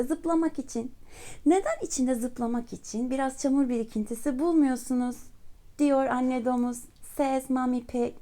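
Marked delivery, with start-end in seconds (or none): none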